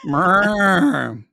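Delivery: long, mock cow moo; playful cow noise. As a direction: do a drawn-out moo. Imitates a cow mooing.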